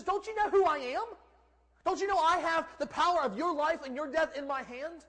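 high, loud, indignant demand; irate ruler throwing his weight around; irate Pilate. Acted. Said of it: "Pilate gets a little irate".

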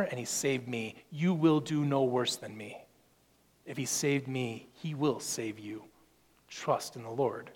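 quiet, tender promise; gentle reassurance. Acted. Paul's gentle invitation.

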